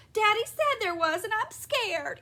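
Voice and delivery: high, loud frightened squeal; scared squealing. A narrator's reading. Mother squealing to daughters about the spider, scared squeal. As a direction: squeal like someone terrified of a spider. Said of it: "continued to squeal".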